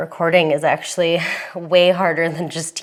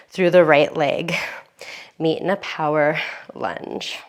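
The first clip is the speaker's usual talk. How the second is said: calm yoga instructor. Acted.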